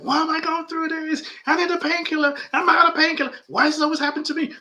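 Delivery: high, frantic whine; panicky inner voice. Retold or imitated. Self-talk of a person in pain, high panicky whine. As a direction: squeal like someone spiraling in panic. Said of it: "So instead of saying".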